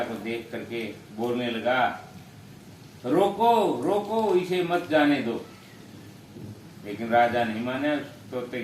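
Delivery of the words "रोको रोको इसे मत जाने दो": high, raised call to stop someone; parrot's urgent call. Acted. Parrot on the banyan calls out about the passing king, urgent high cry.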